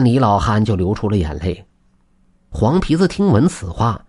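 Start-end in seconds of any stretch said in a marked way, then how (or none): none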